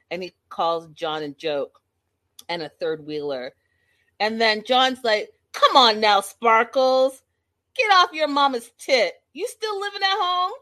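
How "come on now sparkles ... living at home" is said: loud mock Boston heckling; hammed-up Boston taunt; Boston accent. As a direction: jeer in an imitation Boston accent, climbing higher. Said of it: "between the Boston accent".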